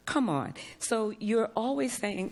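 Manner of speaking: ordinary delivery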